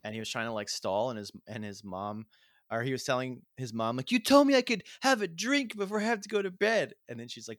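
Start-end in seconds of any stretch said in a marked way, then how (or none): 4.1-6.9 put-on high, loud pleading child; whiny kid voice. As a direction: beg like a kid stalling bedtime. Kid stalling bedtime, quoted.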